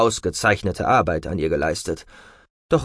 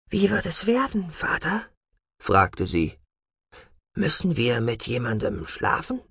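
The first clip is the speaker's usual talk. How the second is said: timid; 6 semitones higher, 4 dB quieter than usual. Acted. Daughter asks fearful question.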